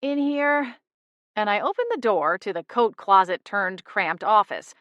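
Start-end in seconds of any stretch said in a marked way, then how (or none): 0.0-0.8 high, drawn-out miserable reply; understated misery, pitched up. A narrator's reading. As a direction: answer miserably from the next room. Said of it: "A miserable voice replied".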